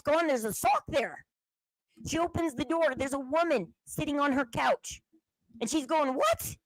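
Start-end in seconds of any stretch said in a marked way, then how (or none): 6.1-6.6 high startled what; mother stunned to find a stranger, shrieking what; shocked what. Retold or imitated. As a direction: gasp it out in disbelief. Mother finds stranger on couch.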